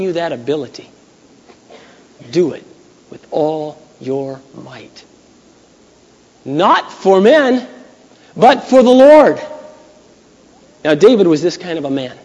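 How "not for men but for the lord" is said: slow, rising and louder, word by word; slow emphatic climax. Real, not acted. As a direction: drive it home to the congregation. Sermon climax.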